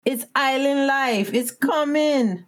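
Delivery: slow, high, unhurried Jamaican lilt; calm them down island style; Jamaican lady. Retold or imitated. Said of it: "the lady was like".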